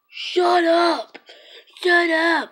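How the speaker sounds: high, loud retold scream. Shooter yelling at screaming girls, screaming it. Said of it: "like, screaming at them".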